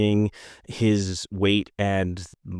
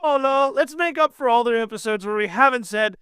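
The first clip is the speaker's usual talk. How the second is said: high sing-song name call; 12 semitones higher than usual. Pretend. Hosts chanting a missed name.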